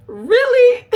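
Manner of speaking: very high, loud, squealed really; shrill mock shock; shrieked really. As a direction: peak disbelief. Third incredulous reaction.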